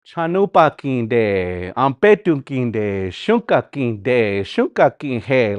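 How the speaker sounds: put-on slow, careful, low old-style Dakota. Shown as a demonstration. Teacher showing the slow old style to students. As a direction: draw out each "kinde" slowly. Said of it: "I use the old style".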